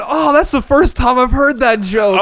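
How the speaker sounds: high, laughing mock-sincere sarcasm; sarcastic comeback. Answering the stranger's old joke, high laughing sarcasm. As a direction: play it dry and sarcastic through a laugh.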